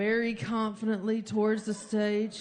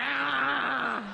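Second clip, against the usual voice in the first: higher, laughing yelp; mock yell; 3 semitones higher than usual. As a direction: let out a sputtering cry while chugging. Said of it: "I'm like".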